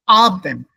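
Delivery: Abend with a loud, stressed A; accent on first syllable. Shown as a demonstration. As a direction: hit the start of Abend. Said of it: "The accent is here".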